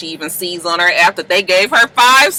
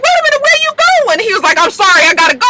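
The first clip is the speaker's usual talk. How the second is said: high mock voices; 5 dB louder, faster than usual. Girls and Nathan voiced.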